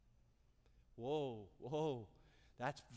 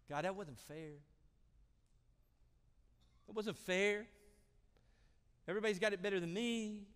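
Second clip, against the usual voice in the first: mock whine to God; whining complaint; 7 semitones higher, 7 dB louder than usual. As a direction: complain like it's unfair. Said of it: "We whine".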